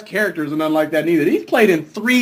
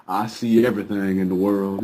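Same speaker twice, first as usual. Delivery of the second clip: low, quiet pompous line; mock grand deity voice; 5 dB quieter than usual. Mocking Heimdall's line in a low, quiet voice. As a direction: intone it like a bored god.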